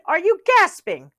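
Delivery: irritated rabbit demand. A narrator's reading. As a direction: ask it annoyed. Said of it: "said Rabbit, irritated".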